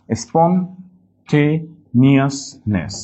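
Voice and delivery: very slow "স্পন্টেনিয়াসনেস", piece by piece; broken into syllables. Shown as a demonstration. Teacher helping class remember, "স্পন্টেনিয়াসনেস" slowly split. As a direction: leave gaps between the syllables of "স্পন্টেনিয়াসনেস". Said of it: "একটু ভেঙে নিলে".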